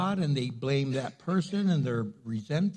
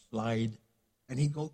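ordinary talk

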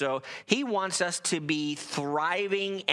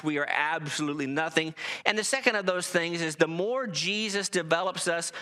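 ordinary delivery

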